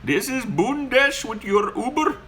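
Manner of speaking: high, polite mock Indian accent, slow on the intro; imitating an Uber driver's Indian accent. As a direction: play the overly formal driver with a put-on Indian accent. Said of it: "and he's like".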